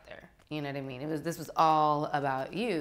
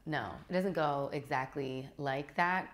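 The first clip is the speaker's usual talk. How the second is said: normal talking voice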